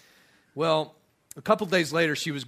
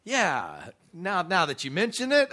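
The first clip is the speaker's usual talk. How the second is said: mock sarcastic comeback, a bit higher; snarky retort; 6 semitones higher, 6 dB louder than usual. Wife's imagined snappy reply to the guy, sarcastic. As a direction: toss it back with a smirk. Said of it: "she might have said".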